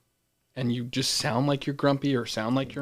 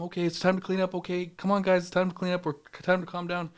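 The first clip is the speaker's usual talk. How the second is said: higher-pitched gentle coaxing; too-nice camp leader; 5 semitones higher than usual. Retold or imitated. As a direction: imitate asking kids sweetly to tidy up. Imitating too-nice leader asking kids.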